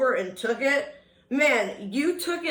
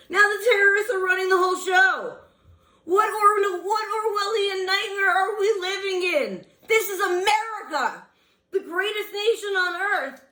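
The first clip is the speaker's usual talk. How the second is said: outraged; 7 semitones higher than usual. Real, not acted. An angry citizen ranting at the president, outraged. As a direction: rise into outrage. Indignant rant rising.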